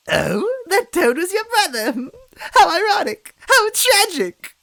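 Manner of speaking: very high, loud laughing shriek; mocking laughter. A narrator's reading. Villain mocking the lost brother, shrieking laughter. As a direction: shriek with delight, slowing on tragic.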